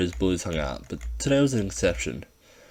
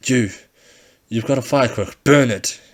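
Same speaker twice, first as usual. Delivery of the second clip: loud, higher frantic shout; panicked order; 5 semitones higher, 8 dB louder than usual. Acted. Ringleader panicking.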